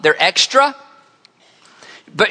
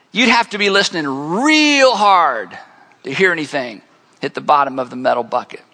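ordinary talk

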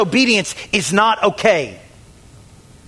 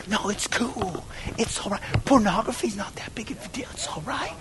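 mock soothing near-whisper; very soft, brushing it off under the breath; hushed excusing; 12 dB quieter than usual. Sinner excusing pornography, hushed and placating.

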